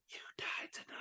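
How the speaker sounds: menacing unvoiced whisper into someone's ear; a whispered threat to the boy; whisper in his ear. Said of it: "she was, like, in Spider's ear".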